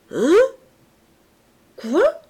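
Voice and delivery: high, loud startled stammer; stammering drunk. A narrator's reading. Drunken uncle playing dumb at the mention of money, high startled stammer. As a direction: feign drunken confusion. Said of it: "bégaya-t-il".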